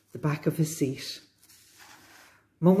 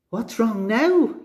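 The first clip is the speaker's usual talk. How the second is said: slow, higher, exasperated storybook dad; mild yell; 7 semitones higher, 3 dB louder, slower than usual. A narrator's reading. Dad responding to Susie's screaming, mild yell. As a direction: raise the pitch into a restrained yell. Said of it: "yelled Dad".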